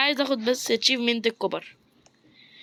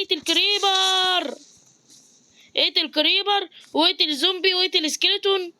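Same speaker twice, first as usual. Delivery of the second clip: higher mock announcer reading the list; reading out game quest; 7 semitones higher than usual. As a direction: read the tasks like a chirpy game prompt. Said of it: "وفي حاجة بيقول لي".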